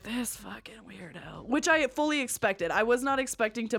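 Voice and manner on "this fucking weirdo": hushed, slow aside; judging onlookers. Retold or imitated. His friends eyeing her, hushed disapproval. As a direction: say it very softly, drawing it out. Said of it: "looking at me like".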